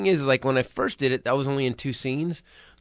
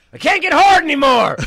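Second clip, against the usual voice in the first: loud, high, laughing dad quote; dad's blurted confession; 12 semitones higher, 8 dB louder than usual. Retold or imitated. Dad blurting at the car show, loud and high. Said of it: "He goes".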